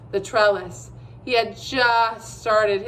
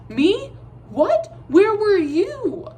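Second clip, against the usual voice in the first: high, loud, stammering disbelief; startled boy turning the blame back; flustered surprise; 11 semitones higher, 5 dB louder, much slower than usual. A narrator's reading. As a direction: caught off guard, throw it back at her. Startled boy defends himself.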